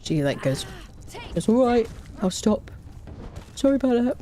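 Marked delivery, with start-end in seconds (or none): 1.3-4.2 higher, lightly squeaky voice for a cat. Acted. Streamer voicing her cat Squeaky, squeaky voice. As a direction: speak up high as a little cat. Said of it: "But in a squeakier voice".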